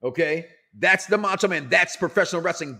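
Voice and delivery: normal talking voice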